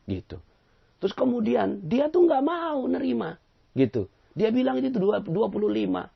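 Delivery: high, loud indignant whine to the judge; teacher whining to Judge Bao about the man; whiny complaint. Acted.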